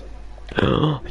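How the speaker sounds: breathy mock-shocked gasp. Teasing a friend as a bad boy, mock gasp. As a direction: suck in air as if scandalised.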